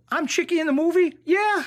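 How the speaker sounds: high, loud, incredulous exchange; retold astonishment. Extra learns his movie name, retold astonishment. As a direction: reenact finding out, pitch shooting up.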